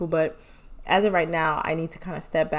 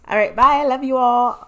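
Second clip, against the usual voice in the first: louder and higher, bright sign-off; loud cheery farewell; bubbly goodbye; 5 semitones higher, 6 dB louder than usual. Real, not acted. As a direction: gush the goodbye.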